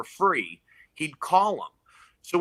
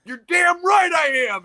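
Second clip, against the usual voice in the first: much higher, louder, slow cocky yell; cocky shout; 8 dB louder, slower than usual. Retold or imitated. Comic shouts back at the biker, cocky and loud. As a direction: roar back with full swagger.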